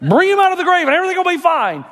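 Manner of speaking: mock the listener who wants it over quickly; impatient listener. Preacher voicing a doubter urging Jesus on, high and fast. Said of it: "You say".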